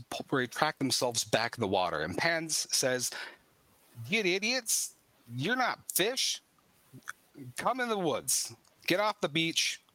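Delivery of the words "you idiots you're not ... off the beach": put-on high, bossy boy's voice; a lightly voiced feral child scolding. Wild boy scolding the party to leave the beach.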